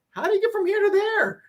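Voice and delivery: sudden high, baffled squeak of a question. Retold or imitated. Mocking the reader of a success story with a high baffled question. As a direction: pitch it way up in mock confusion. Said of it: "so many parts you go".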